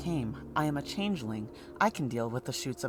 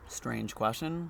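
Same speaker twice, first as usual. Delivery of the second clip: ordinary talk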